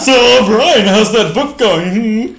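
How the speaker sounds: high, slow cartoon character voice. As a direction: stretch it out in a squeaky cartoon voice. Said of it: "Why are we talking like cartoon characters?".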